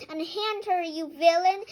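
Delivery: loud, high scream at the villain. A narrator's reading. The bone screams at the fox to unhand Pearl, loud high scream. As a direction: yell it to stop him. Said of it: "the bone screamed".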